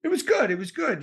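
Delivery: high, chipper reassurance; mock chipper. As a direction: brightly brush it off, up high. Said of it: "And the guy said".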